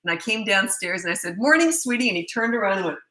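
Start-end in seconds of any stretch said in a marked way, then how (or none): 1.4-2.1 high, bright sing-song hello; cheery mom greeting her teenage son, high. Retold or imitated. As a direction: chirp it out cheerfully.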